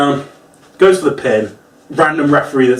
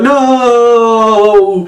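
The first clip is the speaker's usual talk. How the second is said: anguished no; 8 semitones higher, 6 dB louder than usual. Retold or imitated. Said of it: "I was like".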